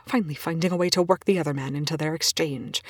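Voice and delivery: ordinary delivery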